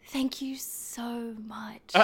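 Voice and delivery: breathy, slow mock thanks; mock-flattered whisper. [name] retells thanking the Bronx guy in a slow mock whisper. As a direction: whisper it like a fake-touched compliment. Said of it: "I was like".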